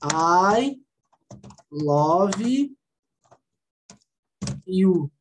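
each word stretched, with long pauses between. Shown as a demonstration. Teacher mimicking writing it by sound, word by word. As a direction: spell it out slowly as it sounds. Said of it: "Mas você não escreve, por exemplo, assim".

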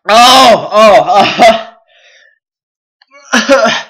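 loud, high moans of pain; pained cries. Real, not acted. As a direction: howl from the burn. Mouth burning from hot sauce.

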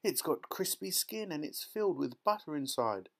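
high, rapturous relishing; excited child marvelling at a feast; thrilled girl. A narrator's reading. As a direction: savour every mouthful with delight. Violet marvels at the magic meal.

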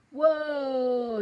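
long, loud, high "whoa"; drawn-out cry, very loud and high; falling yell. A narrator's reading. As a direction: yell as you plummet. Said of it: "he cried".

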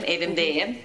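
ordinary talk

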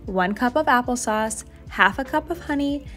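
ordinary talk

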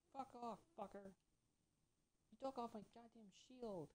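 high-pitched, exasperated protest; real indignant outburst. Real, not acted. Player cursing the enemy that took his shield, high and indignant.

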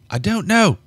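high frightened outburst; frightened cry. Acted. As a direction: cry it out in fear. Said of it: "she cried".

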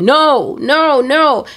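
high mock cry of refusal; re-enacted panicked scream, not full volume; retold scream. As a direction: cry out each NO with pitch swooping up. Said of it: "I just started screaming".

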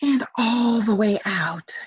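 calming breath demonstration; deep breath demo. Shown as a demonstration. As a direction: show the kids how to breathe all the way out. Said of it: "Do that with me, everybody".

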